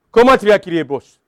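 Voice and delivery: ordinary delivery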